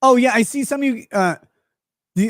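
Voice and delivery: usual speaking voice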